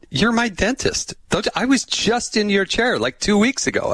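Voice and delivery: high-pitched retold outrage, hammed up; mock mad. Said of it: "he get mad at me".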